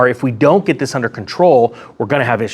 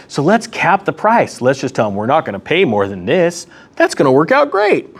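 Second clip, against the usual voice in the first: sarcastic. Acted. Vlogger mocking the EU price cap, sarcastic. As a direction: sell the dumb plan with fake cheer.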